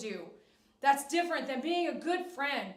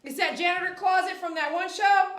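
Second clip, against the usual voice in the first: louder, higher, quicker call across the room; 6 semitones higher, 8 dB louder than usual. Real, not acted. Joking call to child.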